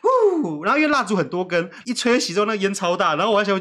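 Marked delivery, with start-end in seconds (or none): none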